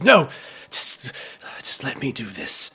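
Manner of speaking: loud, urgent outburst; desperate protest. Acted. Man grabbed at the door begs to continue, desperate and loud. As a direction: cry out as if pulled back from the door. Grabbed Anderson resists being pulled back.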